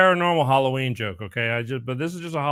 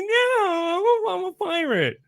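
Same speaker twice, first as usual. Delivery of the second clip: put-on high, slow nasal-lisped kid voice; kid with a cleft lip; slower than usual. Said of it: "he kinda talks like this".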